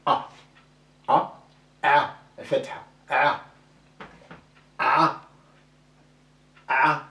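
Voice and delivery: A pressed from the throat each time; throaty ain vowels. Shown as a demonstration. Teacher drilling ain with vowels. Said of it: "si j'écris A avec les voyelles".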